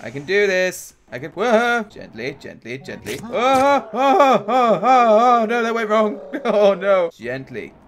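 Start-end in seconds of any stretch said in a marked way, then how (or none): 1.8-3.2 soft, low "gently"; careful coaxing. Real, not acted. Player easing through a tricky spot, soft careful coaxing. 3.2-7.1 loud, high string of oh's; alarmed yelping. Real, not acted. Player whose careful move went wrong, loud alarmed cries. 7.1-7.7 soft, low "gently"; careful coaxing. Real, not acted. Player retrying a tricky spot, soft careful coaxing.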